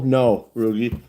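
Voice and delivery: ordinary talk, nothing marked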